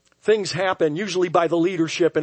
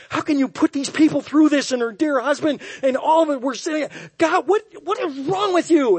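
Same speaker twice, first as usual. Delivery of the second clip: much higher and louder, exasperated; hammed-up outrage at the Lord; indignant rant at God; 11 semitones higher, 5 dB louder than usual. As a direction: hurl it upward like a frustrated complaint. Pastor rants at God.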